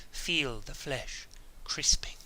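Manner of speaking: slow, soft, eerie whisper; slow eerie whisper of the Bene Gesserit witch. Retold or imitated. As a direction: hiss it slowly like a menacing witch. Witch voiced in a story.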